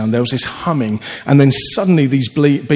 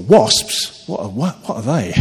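normal talking voice

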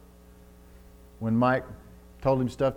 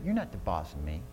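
soft hypothetical wife; 7 semitones lower, 8 dB quieter than usual. Pretend. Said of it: "would she ever say".

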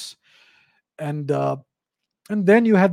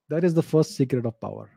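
usual speaking voice

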